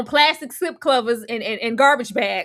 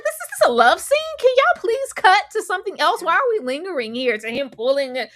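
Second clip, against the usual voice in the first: exasperated viewer; 7 semitones higher, 4 dB louder than usual. Retold or imitated. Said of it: "I was like".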